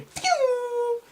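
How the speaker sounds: high long whistling whoosh past the ear; whizzing whistle. Retold or imitated. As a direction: imitate a firework skimming your ear. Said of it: "That's all I could hear".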